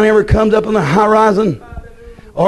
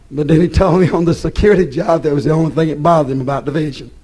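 ordinary talk

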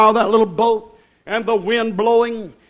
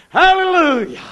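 high ringing praise shout; preacher's exultant cry; shouted hallelujah; 7 semitones higher, 3 dB louder than usual. Real, not acted. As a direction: lift it up high and loud. Preacher exclaims again.

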